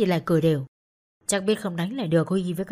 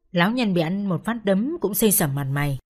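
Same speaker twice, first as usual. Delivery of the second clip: usual speaking voice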